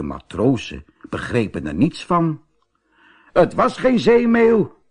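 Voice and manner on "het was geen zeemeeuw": high, loud sailor voice. A narrator's reading. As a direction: say it like an amazed sailor. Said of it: "zei een van hen".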